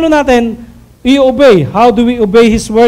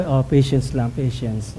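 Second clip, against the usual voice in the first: much softer, lower self-soothing; calming self-talk; 11 semitones lower, 9 dB quieter than usual. Pretend. Husband calming himself while driving, in a soft low murmur. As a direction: talk yourself down softly.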